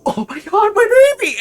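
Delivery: high, loud overjoyed mother; tearful mom. Acted. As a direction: burst out with relief. Mom reunites with child.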